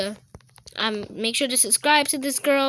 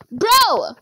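exasperated shout; 8 semitones higher, 6 dB louder than usual. Real, not acted.

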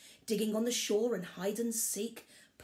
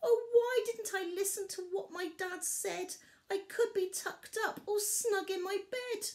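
sobbing cub; 12 semitones higher than usual. A narrator's reading. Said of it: "Barley sobbed alone".

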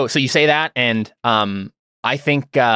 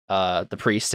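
usual speaking voice

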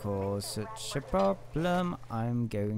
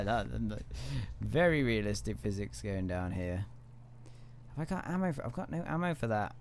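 normal talking voice

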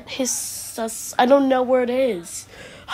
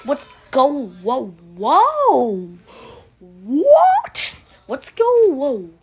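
high, loud, stretched-out "what" and "whoa"; startled; 3 semitones higher, 7 dB louder, slower than usual. Acted. Character alarmed at what's going on, startled. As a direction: cry out in shrill-pitched, loud disbelief.

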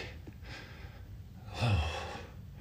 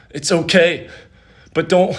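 strained, loud effort; straining; 6 semitones higher, 9 dB louder than usual. Real, not acted. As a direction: push words out under strain. Straining mid exercise.

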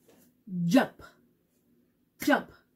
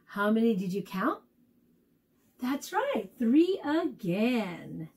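usual speaking voice

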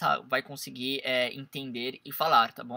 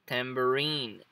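tambourine drawn out on the last syllable RINE; stress on final RINE; much slower than usual. Shown as a demonstration. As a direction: slowly lean on RINE in tambourine. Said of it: "aqui vai entrar a entonação". (tambourine com a tônica no rine final)

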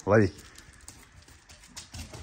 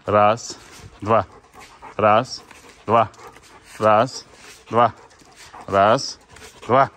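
loud commands; 7 dB louder than usual. Real, not acted. Counting steps for the dog.

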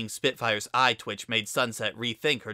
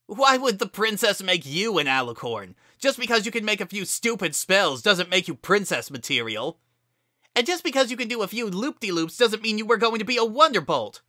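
angry shouting; 10 semitones higher, 5 dB louder than usual. A narrator's reading. Heated argument.

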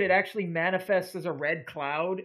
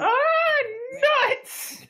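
whiny cry; 3 dB louder than usual. Retold or imitated. Quoting character's cry.

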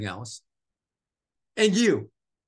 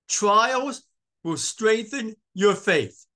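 loud, high, slow declaration; emphatic proclamation; 8 semitones higher, 5 dB louder, much slower than usual. Real, not acted. Preacher announcing a takeaway to congregation, slow and emphatic. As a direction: ring out each word as a key point.

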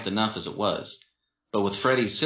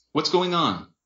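moderately raised questioning voice; puzzled boyfriend; 7 semitones higher than usual. A narrator's reading. Boyfriend finally reaching them, puzzled. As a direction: ask what's up, less urgent.

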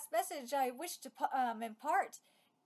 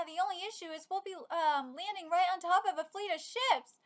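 ordinary talk

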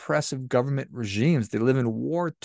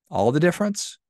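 "All the difference?" asked back with a sharp rising tune on "difference", pitched higher; questioning echo; 6 semitones higher than usual. Shown as a demonstration. Speaker voices the listener's doubt, rising question. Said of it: "Yeah, all the difference".

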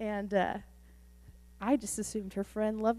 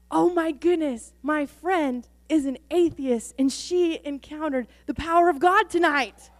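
higher, louder gushing news; excited; 6 semitones higher, 6 dB louder than usual. Retold or imitated. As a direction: blurt the amazing news. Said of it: "and she goes".

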